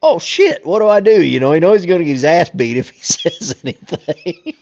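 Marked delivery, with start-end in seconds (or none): 0.0-1.2 high, loud mock panic; the dog frets in a high squeak; panicked dog voice. Voicing the scared pit bull, high mock panic. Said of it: "And he's like".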